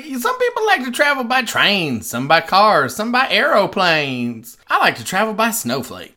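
put-on high old-timey announcer voice; old newsreel narrator. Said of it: "old-timey voice just pops out".

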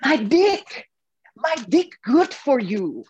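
put-on high, loud Balinese-accented old man; Ketut's thick Balinese accent, pitched high; imitating Ketut. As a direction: mimic the old priest's broken English with a grin. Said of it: "And he says".

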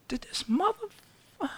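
hushed, high, stunned disbelief; half-whispered can't-believe-it under the breath. Retold or imitated. Hearing a colleague's remark, stunned, high and hushed.

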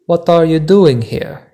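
put-on light Italian accent on the question; a hint of a thick Italian accent; lightly voiced Italian man asking in an Italian accent. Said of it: "he replied with a thick Italian accent".

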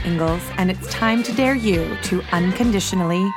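normal talking voice

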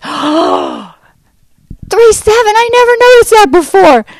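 astonished hostess. Retold or imitated. Hostess seeing the stopped clock, astonished and high.

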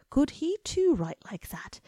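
whisper. A narrator's reading. Inner wish, whispered.

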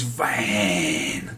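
VAN with a long, stretched-out vowel; mock drawn-out "van"; imitated pronunciation. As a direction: draw out van, mimicking how he says it. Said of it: "That's how he says it".

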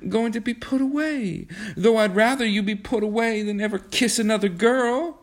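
put-on high-pitched, stammering girl's plea; passionate protest. A narrator's reading. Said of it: "she protested passionately".

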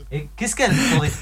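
distant, off-mic voice; speaking away from the microphone; far from mic. Real, not acted. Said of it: "tu parles loin du micro, on t'entend mal".

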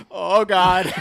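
a high, loud, overdone gasp of fear; mock scared wife. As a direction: ham up the wife's fright. Wife panics on the phone.

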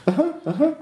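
high, rapid uh-huhs; a cowed hostage agreeing to everything; nervous agreement. Acted.

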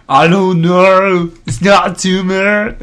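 high, slow hammed-up French; goofy French voice, slow; mock French accent. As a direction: drawl it like a cartoon Frenchman. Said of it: "Now he's French?".

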